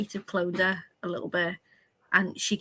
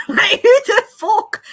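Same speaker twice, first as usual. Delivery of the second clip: laughing outburst; 12 semitones higher, 11 dB louder than usual. Real, not acted.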